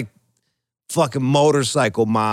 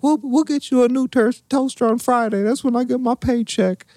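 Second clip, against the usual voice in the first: much higher girly mock voice; imitating Bethany; 10 semitones higher than usual. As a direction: chirp it brightly up high like Bethany. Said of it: "And Bethany's like".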